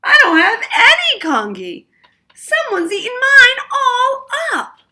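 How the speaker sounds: put-on very high, loud child's wail breaking into crying; a little boy howling over lost congee. A narrator's reading. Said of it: "Little Chan wailed".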